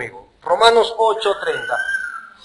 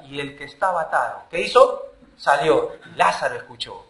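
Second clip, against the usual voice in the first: ordinary talk, nothing marked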